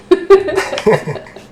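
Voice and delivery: loud, high burst of laughter; real laughter. Real, not acted. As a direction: laugh out loud at your own silly attempt. Laughing at own failed accent.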